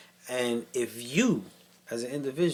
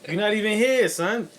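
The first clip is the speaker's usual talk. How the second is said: playful shout; 8 semitones higher, 5 dB louder than usual. Real, not acted. Louder repeat of tease.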